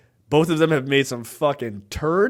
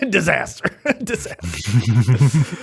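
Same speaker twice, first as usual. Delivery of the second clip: laughing through the word; 3 semitones lower, much slower than usual. Real, not acted. Co-host reading out a film list hits a flop, laughing. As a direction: crack up as you say it.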